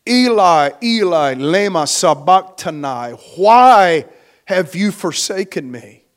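preacher's mock cry, swelling loud on WHY; retold scream. Preacher acts out Jesus screaming from the cross, restrained scream. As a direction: cry out each word, peak on why, then drop. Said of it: "He screams from the cross".

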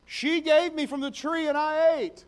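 whiny excuse. Acted. Adam blames Eve to God.

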